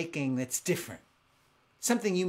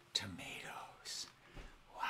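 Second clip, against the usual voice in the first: awed whisper; 8 semitones lower, 16 dB quieter, slower than usual. Real, not acted. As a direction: breathe the word in an awed whisper. Awed over fifteen thousand kinds.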